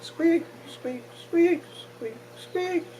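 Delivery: very high squeal imitation; squeaky hides.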